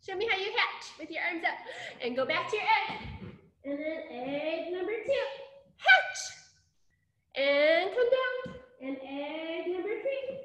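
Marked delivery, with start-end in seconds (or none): 5.8-6.2 sudden high, louder squeal; excited call-out. Pretend. Storyteller cueing egg number two to hatch, excited squeal. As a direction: burst out brightly like a hatching egg.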